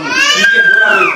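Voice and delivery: high, loud shout to the crowd; shouted quiz. Real, not acted. Preacher quizzing the congregation, shouting high. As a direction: cry out the question, very high. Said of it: "preacher quizzes congregation".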